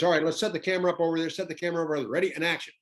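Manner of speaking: fast, high, loud director voice; Clint Eastwood. Retold or imitated. Director ordering the camera crew about, brisk loud voice. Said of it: "We were talking like this".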